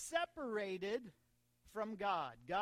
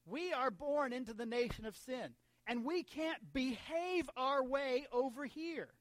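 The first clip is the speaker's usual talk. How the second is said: slow, forceful pulpit delivery; emphatic preaching; 4 semitones higher, 6 dB louder than usual. Real, not acted. Preacher telling church nobody can earn it, emphatic preaching. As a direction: hammer each key word to the congregation. Preacher drives home his point.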